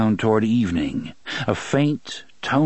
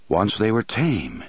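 ordinary delivery

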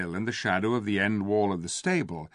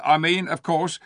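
ape voice; 7 semitones higher, 5 dB louder than usual. A narrator's reading. Ape corrects himself.

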